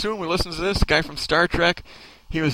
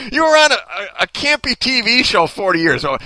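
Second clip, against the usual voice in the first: ordinary talk, nothing marked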